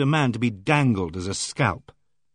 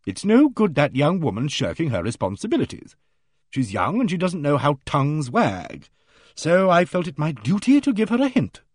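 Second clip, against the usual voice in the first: hearty bossy lady; 4 semitones higher than usual. Acted. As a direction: play her booming and self-assured. Aimee Griffith's hearty voice.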